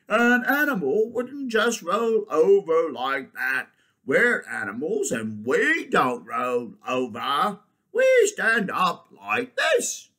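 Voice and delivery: loud, drawn-out bear voice; lumbering bear. Acted. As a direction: explain it slowly, loudly as a bear. Bear figure in story.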